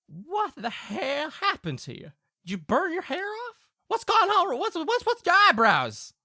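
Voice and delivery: high-pitched incredulous country father; shocked Southern Pa. Acted. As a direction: question your boy in comic alarm. Pa startled by son's burnt hair.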